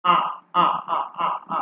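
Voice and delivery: আ from deep in the throat; throat vowel. Shown as a demonstration. Teacher showing students the আ from the throat. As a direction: open আ voiced from the throat. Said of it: "একদম গলা থেকে উচ্চারণটা হবে".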